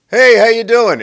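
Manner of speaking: high, loud breezy hello; exaggerated fake-friendly holler; mock hearty greeting. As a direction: call it out cheerily as if walking past. Mimics hollow greeting in passing.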